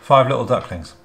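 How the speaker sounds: said while being tickled, loud. Real, not acted. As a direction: squirm through it, being tickled. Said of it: "Stop tickling me".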